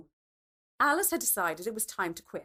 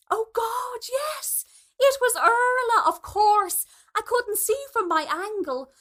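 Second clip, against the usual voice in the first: flustered; 10 semitones higher, 6 dB louder than usual. A narrator's reading. Alice caught out, covering quickly.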